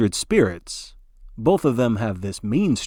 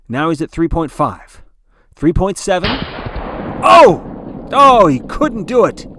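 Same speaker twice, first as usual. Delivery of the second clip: announcer's voice jumps much higher and louder into a cry; frantic climax; 6 semitones higher, 9 dB louder than usual. Acted. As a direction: shout the disappointment, it slipped away. Announcer watching speed fall short.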